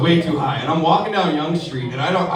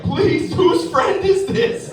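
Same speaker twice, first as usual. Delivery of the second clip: exasperated owner; 12 semitones higher, much slower than usual. Retold or imitated. Club owner pleading.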